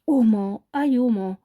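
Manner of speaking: sudden shout. A narrator's reading. Woman suddenly warns the door guard of smoke, mild shout. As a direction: call it out suddenly, without a full yell. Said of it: "empezó a gritar de repente".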